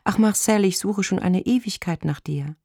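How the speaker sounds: high voice, full of longing; longing woman caller. A narrator's reading. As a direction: sigh to a long-sought lover. Woman caller greets supposed lover.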